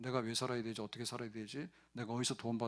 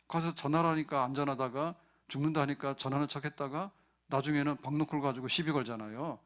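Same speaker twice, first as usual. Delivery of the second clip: normal talking voice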